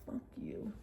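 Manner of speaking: voiceless whisper under the breath; whispered insult. Retold or imitated. Storyteller imagining cursing the ejected man, whispered insult. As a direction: whisper it like a secret jab. Retelling an insult not really said.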